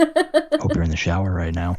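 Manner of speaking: low sultry voice, laughing through; sultry narrator. Pretend. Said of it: "my low, sultry voice".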